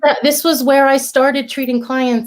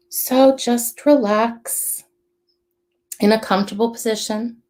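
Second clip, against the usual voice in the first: guided meditation; 2 semitones lower than usual. Acted. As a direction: guide them gently into calm.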